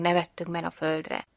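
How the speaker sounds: plain reading voice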